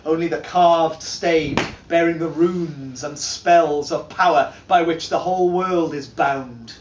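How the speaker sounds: ordinary delivery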